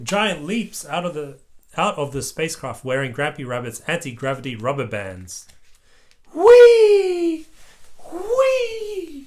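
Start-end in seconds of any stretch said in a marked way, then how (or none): 6.3-9.3 very high squealing whee; gleeful whee. Acted. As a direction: squeal like a kid bouncing. Kids bouncing on the moon.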